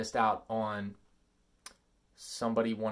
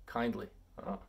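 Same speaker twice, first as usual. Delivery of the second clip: laughing at himself; 2 semitones lower, 6 dB louder than usual. Real, not acted. Laughing at his own letter.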